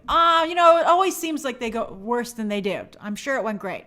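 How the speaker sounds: ordinary talk, nothing marked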